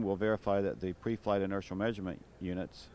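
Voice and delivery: ordinary delivery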